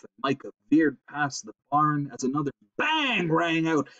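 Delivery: usual speaking voice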